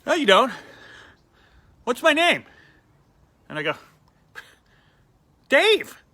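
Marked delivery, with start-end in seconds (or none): 0.1-2.4 high, louder confrontational demand; accusing challenge. Retold or imitated. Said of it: "And he goes". 5.5-6.0 high, loud confident answer; bold guess. Retold or imitated.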